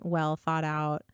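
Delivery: normal talking voice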